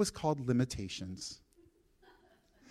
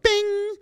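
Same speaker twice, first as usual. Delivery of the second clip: a bright, high ding like a bell; bing sound effect; 6 dB louder than usual. Retold or imitated. Pastor telling church how God answers, high bing. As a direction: pop out a cartoon bing, much higher and louder.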